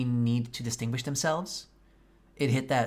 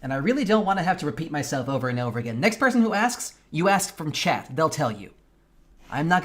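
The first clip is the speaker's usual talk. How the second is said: irritated; 4 semitones higher, 6 dB louder than usual. Real, not acted. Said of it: "I am so fucking angry right now".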